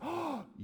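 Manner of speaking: high, sudden acted gasp; mock-astonished sharp intake of breath; gasp of recognition. Woman suddenly recognizing her pastor, startled gasp.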